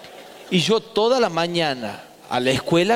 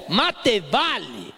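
padre gruñón; 8 semitones higher than usual. Retold or imitated. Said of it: "padre rezonga al hijo".

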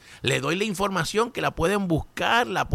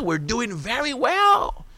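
mock gleeful; 8 semitones higher, slower than usual. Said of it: "los trompitos".

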